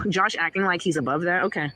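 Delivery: normal talking voice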